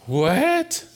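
high, incredulous yelp; can't believe what he just heard; shocked what. Acted. Jack told to let go.

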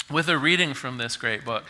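usual speaking voice

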